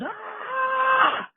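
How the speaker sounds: long, high-pitched mock scream; imitated scream. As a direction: shriek like an attacker charging. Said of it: "just screams".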